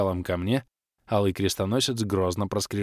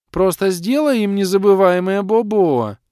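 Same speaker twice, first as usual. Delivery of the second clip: put-on high, loud childish demand; shrill spoiled girl; 11 semitones higher, 9 dB louder than usual. A narrator's reading. Капризная дочь требует расправы. (капризно и громко, высоким голосом)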